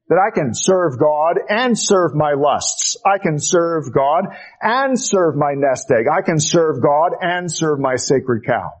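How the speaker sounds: normal talking voice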